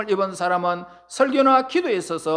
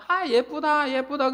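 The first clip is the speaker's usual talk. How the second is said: lightly imitated children's admiration, higher pitch; a hint of delighted children, pitched up; admiring children; 6 semitones higher than usual. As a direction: lightly voice kids cooing over flowers. Children admiring flowers, voiced by preacher.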